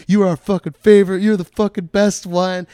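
loud, high drunken-crowd enthusiasm, acted; rowdy fan praise. Army people praising the comic, imitated rowdy shouting. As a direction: imitate cheering fans at full voice.

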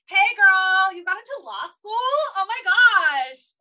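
mock Valley-girl squeal of delight; Elle Woods gush. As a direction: gush like an overexcited best friend. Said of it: "it felt it again where she was like".

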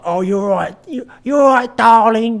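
high-pitched, hammed-up Cockney woman; dramatic Cockney accent. Imitating a dramatic Cockney woman, exaggerated Cockney accent. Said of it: "dramatic Cockney accent on a woman".

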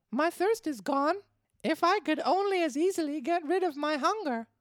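put-on high, loud puppet-boy grumble; grumbling Pinocchio. Pinocchio still hungry after his drink, high grumbling voice. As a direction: complain in a little puppet's voice. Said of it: "he grumbled as he wiped his mouth".

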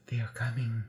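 low, hushed omen; creepy warning. Retold or imitated. Imitating the movie's eerie voice at the party, creepy and hushed. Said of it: "That was the creepiest thing".

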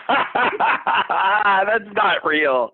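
laughing, slow, high shout; laughing disbelief. Real, not acted. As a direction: cry out through laughter. Reacting to absurd review.

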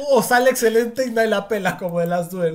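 laughing through agreement; chuckling while agreeing. Real, not acted. Cohost laughs agreeing about The Last Duel, laughing.

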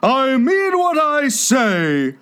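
high, slow, offended Mock Turtle; huffy and hurt. A narrator's reading. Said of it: "in an offended tone".

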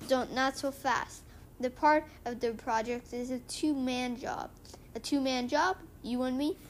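usual speaking voice

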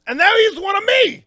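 high, loud outburst; sudden high-pitched yell; excited shout. Real, not acted. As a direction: burst out with it.

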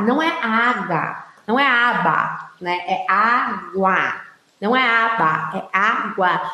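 mock nagging correction, "água" drawn out; correcting child. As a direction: keep correcting the child, lightly voiced. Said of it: "ficar corrigindo".

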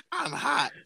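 ordinary talk, nothing marked

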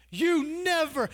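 put-on indignant older son. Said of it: "He's all".

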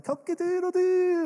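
high crowing rooster call. Retold or imitated. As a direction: crow like a rooster. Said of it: "and I go".

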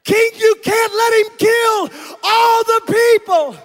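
pleading queen. Acted. Esther pleading to the king.

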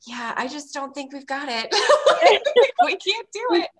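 laughing through her reply to her daughter; laughing at herself. Retold or imitated. Mother answers her daughter's accents, laughing. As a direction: giggle through the admission. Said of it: "and I'm like".

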